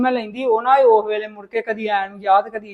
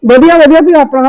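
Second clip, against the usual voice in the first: louder, bright-eyed hello to guests; cheerful welcome; 3 semitones higher, 9 dB louder than usual. Acted. Sister welcoming her arriving brother, loud and happy. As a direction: greet them loudly, all delighted.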